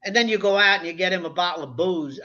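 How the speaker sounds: ordinary delivery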